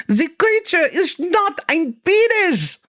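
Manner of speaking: very high, slow, hammed-up German accent; fake German accent. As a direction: play the mad German professor, thick fake accent. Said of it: "her German accent as thick as it was obviously fake".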